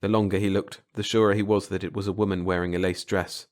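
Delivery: ordinary delivery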